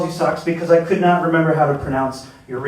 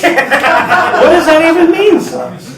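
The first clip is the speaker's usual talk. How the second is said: laughing; 13 semitones higher, 8 dB louder, much slower than usual. Real, not acted. Cracking up at Socks' answer.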